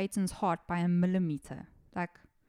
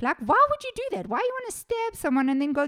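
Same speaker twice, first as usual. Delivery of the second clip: much higher and louder, exasperated; outraged disbelief; 9 semitones higher, 6 dB louder than usual. Real, not acted. Host venting to listeners about the girls, exasperated. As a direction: demand an answer from the killers.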